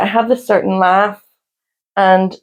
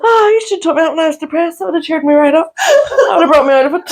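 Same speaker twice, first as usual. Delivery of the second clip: high-pitched, laugh-strained talk; aching with laughter; 13 semitones higher than usual. Real, not acted. Co-host hearing Pat Tayto first time, aching with laughter. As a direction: squeeze the words out through painful laughter. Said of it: "it's hurting me".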